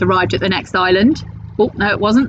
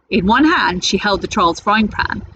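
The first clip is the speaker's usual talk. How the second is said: ordinary delivery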